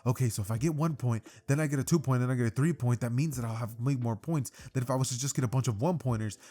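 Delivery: plain reading voice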